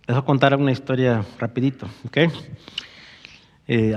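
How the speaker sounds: ordinary talk, nothing marked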